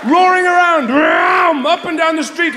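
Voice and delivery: high, loud furious roar; loud comic outrage; roaring rant. Acted. Mock rant about drivers.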